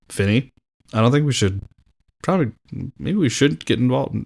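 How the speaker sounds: put-on a weak, half-hearted Australian accent; mock Aussie voice, barely put on; bad Australian accent. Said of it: "That's a bad Australian accent".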